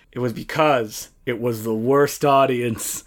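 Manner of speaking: normal talking voice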